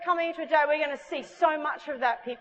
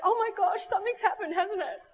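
high, giddy imitation of a girl astonished; amazed; 8 semitones higher than usual. Girl telling the preacher she feels different after prayer, high giddy amazement. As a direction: act out wonder bubbling up, very high. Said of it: "She's like this".

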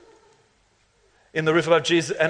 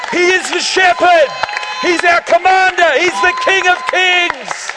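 building from raised voice to high, loud shout; fervent preaching; 7 semitones higher, 5 dB louder than usual. Real, not acted. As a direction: proclaim it with mounting full-force fervor. Sermon declarations building to a climax.

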